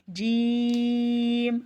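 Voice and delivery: جیم stretched slowly over several beats, nasal m held; long drawn jeem. Shown as a demonstration. Quran teacher drawing out جیم for students. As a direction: hold the م of جیم for three to five counts. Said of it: "تین سے پانچ الف کے جتنا کھینچ کے".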